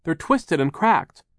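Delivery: high, drawn-out dismay; crying out. A narrator's reading. Violet describes the ruined glasses, crying out. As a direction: lament loudly, stretching the words. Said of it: "Violet cried".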